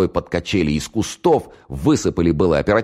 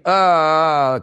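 long, high wail of a wounded man; drawn-out cry from a gut wound; scream of pain; 12 semitones higher, 4 dB louder than usual. A narrator's reading. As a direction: hold out a high-pitched agonized "aaah". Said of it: "кричал он, зажимая руками живот".

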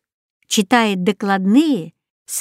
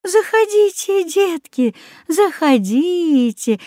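put-on high welcoming old woman, slow at the end; inviting old woman; 9 semitones higher, slower than usual. A narrator's reading. Old woman invites the lost children inside, welcoming. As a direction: draw the children in warmly. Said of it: "пригласила старушка".

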